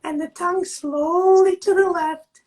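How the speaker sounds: slow, calm instructor voice; guided exercise. Acted. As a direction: guide the class gently, drawing words out. Leads a face yoga exercise.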